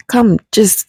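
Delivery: ordinary talk, nothing marked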